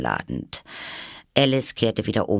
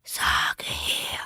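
drawn-out, whispered drowsy question; sleepy caterpillar; 3 dB quieter than usual. A narrator's reading. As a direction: breathe it out sleepily. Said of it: "mit schläfriger, langsamer Stimme". (schläfrig gedehntes Flüstern)

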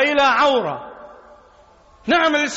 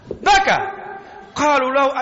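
ordinary talk, nothing marked

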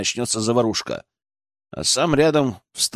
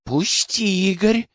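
higher, tense, held-back demand; seething through; 7 semitones higher, slower than usual. A narrator's reading. Алена demands that Игорь let her go, seething. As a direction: push the words out tightly, pitched up. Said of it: "процедила Алена".

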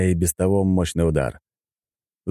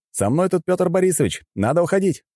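high-pitched urgent call; 12 semitones higher than usual. A narrator's reading. Fighter telling his comrade to leave, calling out urgently. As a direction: call across the fight to him.

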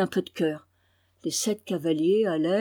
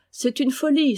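higher, lively protest; laughing exclamation; 6 semitones higher, 3 dB louder than usual. A narrator's reading. Berthe reassuring her friend after laughing, lively exclamation. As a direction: exclaim it brightly, waving the idea away. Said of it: "s'écria-t-elle".

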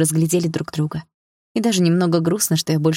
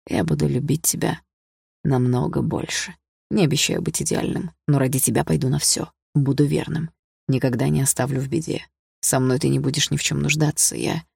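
earnest suitor; 3 semitones lower than usual. Acted. Dima promises Polina lifelong devotion, earnest low voice. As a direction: make a tender vow in a lowered voice. Narrator voices Dima's vow.

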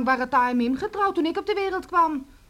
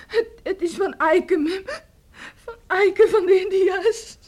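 louder and higher, slowing through sobs; crying confession; 4 semitones higher, 6 dB louder than usual. Acted. Daughter crying as she names the real father. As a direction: force the name out in tears.

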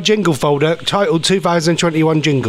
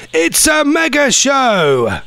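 jingle voice; 6 semitones higher, much slower than usual. Shown as a demonstration. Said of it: "I'm now gonna do you a new jingle".

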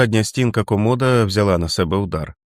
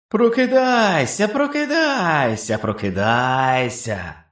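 very slow, much higher eerie wail; inhuman chorus; 8 semitones higher, much slower than usual. A narrator's reading. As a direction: wail it like a ghostly beast chorus. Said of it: "приєднався ще один хор".

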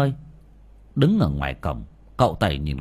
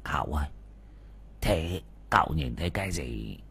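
low, softer curious asking; curious hushed question; 7 semitones lower, 9 dB quieter than usual. A narrator's reading. Old man quietly asks the young master, hushed and curious. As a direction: lean in and ask quietly, lower and softer. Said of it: "cũng tò mò hỏi".

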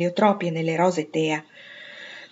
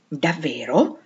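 surprised question with a swooping rise; mildly astonished, caught unawares; wide-eyed surprise; 2 semitones lower, much slower than usual. A narrator's reading. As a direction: ask it in sudden astonishment. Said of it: "E Joe spalancò gli occhi".

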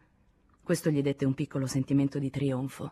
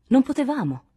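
quick-hearted protest, higher and louder; lively retort; 9 semitones higher, 7 dB louder than usual. A narrator's reading. Lachaume defending his comrades' inaction to Henri, lively. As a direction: answer back with sudden force. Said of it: "disse vivamente Lachaume".

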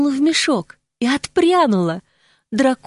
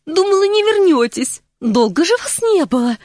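glad; 6 semitones higher than usual. A narrator's reading. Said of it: "не скрывая радости".

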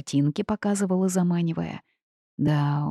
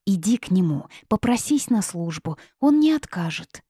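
ordinary delivery, nothing marked

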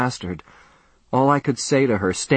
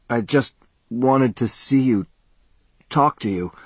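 thick whining tone. A narrator's reading. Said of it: "with a thick whining tone".

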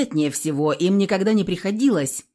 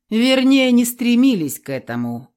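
higher, louder wry smirk; a knowing grin in the voice; 6 semitones higher, 6 dB louder than usual. A narrator's reading. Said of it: "усмехнулся Кощей".

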